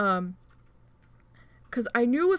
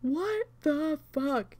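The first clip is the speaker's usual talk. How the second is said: stunned disbelief; 4 semitones higher, much slower than usual. Retold or imitated. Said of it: "And I'm like".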